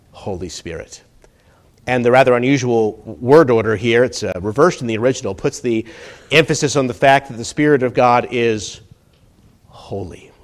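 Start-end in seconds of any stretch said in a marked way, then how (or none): 0.1-1.0 low, near-whispered close; soft, low and reverent; hushed reverence. Real, not acted. 9.7-10.3 very soft, low single word; quiet, weighty and low; hushed emphasis. Real, not acted.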